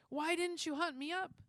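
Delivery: put-on old judge demanding answers. A narrator's reading. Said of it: "demanded the judge".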